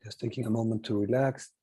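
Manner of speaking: soft, low meditation guide; guided meditation. Acted. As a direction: ease them gently out of stillness.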